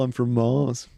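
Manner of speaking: mock Liverpool Beatle accent; [name]. Co-host as a Beatle from Mars, mock Beatles accent. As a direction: say it as a hammy Beatle. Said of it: "I have a perfect impression of him".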